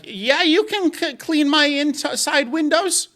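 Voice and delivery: put-on high, slow little old lady voice. Granny asking the window cleaner in, sweet old lady voice. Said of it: "old ladies that'd be like".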